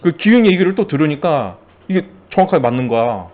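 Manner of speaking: ordinary talk, nothing marked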